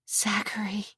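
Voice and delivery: breathless whispered name. Acted. Breathless whisper before kiss.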